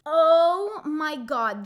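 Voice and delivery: loud, slow, fed-up groan of a phrase; loud drawn-out exasperation; exasperated outburst. Real, not acted. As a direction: let the frustration burst out, drawn out. Exasperated at the recap drama.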